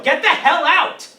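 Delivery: loud, high yell of rage; furious shout. Acted. Furious friend yelling at the couple to leave. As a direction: throw them out with a shout.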